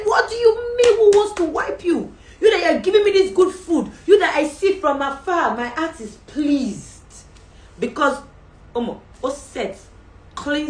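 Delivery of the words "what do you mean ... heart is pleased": high, loud, slowed royal voice; delighted king. Acted. King praises Esther's feast, high and loud. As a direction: play the king, high and ringing.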